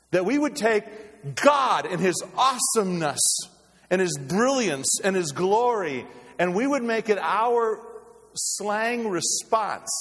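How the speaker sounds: loud, high, indignant preaching; impassioned pulpit outcry with big pauses; full-force preaching. Real, not acted. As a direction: ring out each phrase, dragging the final words.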